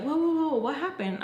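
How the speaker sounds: mock startled "hold on"; retold alarmed reaction. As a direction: stop someone mid-exit, acting it out. Friend stops her as she leaves.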